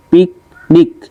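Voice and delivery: পিকনিক split as পিক, নিক; two separate beats on পিকনিক; syllable by syllable. Shown as a demonstration. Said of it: "দুইটা দেওয়া আছে".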